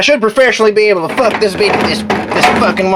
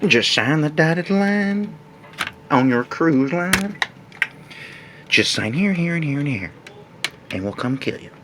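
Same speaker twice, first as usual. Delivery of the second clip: creepy quiet salesman; 3 semitones lower, 10 dB quieter than usual. Acted. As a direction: lure them in, softly menacing. Sinister salesman aside.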